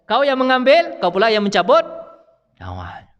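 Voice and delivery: God orders Israel to take souls; divine command. Retold or imitated. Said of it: "itu perintah kepada malaikat Israel". (perintah tegas, lebih tinggi dan keras)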